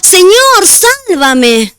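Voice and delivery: high cry for help that drops on the last word; desperate cry. A narrator's reading. Pedro sinking in the lake, desperate high cry. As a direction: call out like a sinking man. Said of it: "Entonces gritó".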